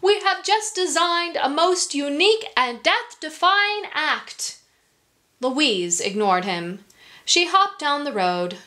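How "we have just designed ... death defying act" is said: ringmaster voice. Acted. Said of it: "the ringmaster said".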